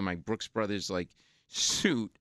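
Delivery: ordinary talk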